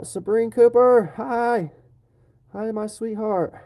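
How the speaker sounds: light up greeting your sweetheart; excited greeting. Real, not acted. Greets partner joining the stream.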